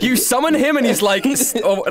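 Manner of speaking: usual speaking voice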